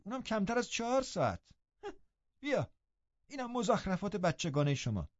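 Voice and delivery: mild mocking nurse; scornful mockery. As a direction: ridicule the girl's love. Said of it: "با لحن هرچه تحقیرآمیزتری".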